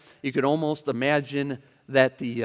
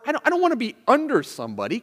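resentful protest; 9 semitones higher, 5 dB louder, faster than usual. Acted. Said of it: "It's a concern for".

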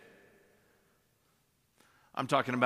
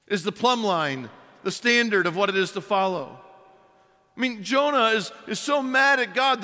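high and loud from the pulpit; impassioned sermon; full-force preaching; 9 semitones higher, 7 dB louder than usual. Real, not acted. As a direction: drive the point home to the congregation.

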